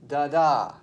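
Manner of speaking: second DA of "Da da" punched loud; light first "Da", strong second "da"; stress on second da. Shown as a demonstration. Teacher demonstrates unaccented then accented, stress on second da. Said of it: "Unaccented syllable, accented syllable".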